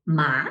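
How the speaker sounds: rising tone on "ma"; second tone. Shown as a demonstration. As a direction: lift "ma" like a question. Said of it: "rising like a questioning intonation".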